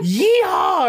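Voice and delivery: high, loud cowboy whoop; mock yeehaw. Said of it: "you fucking going".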